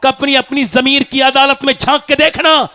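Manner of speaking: loud, high-pitched exhortation; shouted sermon, pitched high and driven hard; full-force preaching. Real, not acted. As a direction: thunder a rebuke at the congregation.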